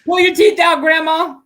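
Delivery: loud, high leering come-on; toothless. Acted. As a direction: call out a cheeky offer loudly. Acting out seducing grandma.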